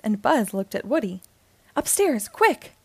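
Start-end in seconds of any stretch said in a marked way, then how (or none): 1.8-2.7 high, urgent command; raised-voice order to hurry; yelled order. A narrator's reading. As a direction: call it out urgently. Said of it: "Woody yelled".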